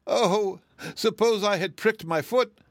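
high-pitched tearful wail. A narrator's reading. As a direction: wail it in self-pity. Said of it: "she wailed".

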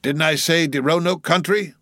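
sharp, high-pitched indignant retort; sharp cry. A narrator's reading. Old cook correcting the questioning mate, sharp. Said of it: "he cried sharply".

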